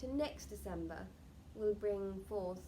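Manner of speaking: ordinary talk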